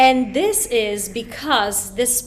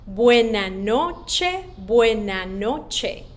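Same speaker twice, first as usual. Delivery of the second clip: Buena noche said slowly, each syllable clear; slow spoken drill; slower than usual. Shown as a demonstration. Teacher drilling kids slowly, slow and clear. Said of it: "Say that one more time with me".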